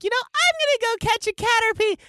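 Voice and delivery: very high, loud mock-child voice; imitated excited kid. Host imagining kids copying the show, hammed-up excited kid. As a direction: squeal it like an overexcited child. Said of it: "how many kids are like".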